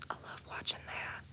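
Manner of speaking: hushed whisper. Real, not acted. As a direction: breathe it softly. Quiet aside watching rain.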